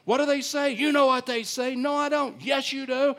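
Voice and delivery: high, loud bickering; heated back-and-forth. Retold or imitated. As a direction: trade quick lines in mock argument. Back-and-forth with TSA man.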